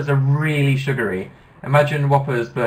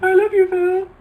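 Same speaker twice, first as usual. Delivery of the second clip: very high honking squeal; goose voice; 5 dB louder, slower than usual. Pretend. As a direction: honk it like a goose. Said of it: "announce that in the voice of a goose".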